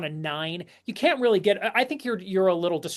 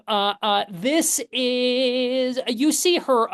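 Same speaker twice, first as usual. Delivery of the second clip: usual speaking voice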